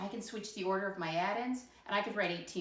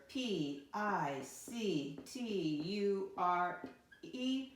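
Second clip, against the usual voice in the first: slow spelling; much slower than usual. Shown as a demonstration. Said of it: "How do I spell".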